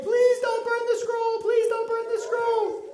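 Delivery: high-pitched mock pleading; acted begging. Officials begging the king to save the scroll, pleading. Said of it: "you guys have to beg".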